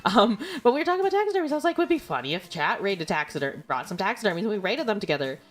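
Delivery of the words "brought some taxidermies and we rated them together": ordinary talk, nothing marked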